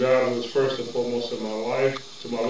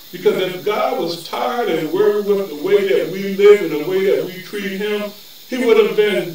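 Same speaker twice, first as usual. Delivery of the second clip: raised-pitch sermon delivery, building in force; fervent preacher exhorting the believers; impassioned preaching; 5 semitones higher, 4 dB louder than usual. Real, not acted. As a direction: preach it with mounting fervor, leaning on key words.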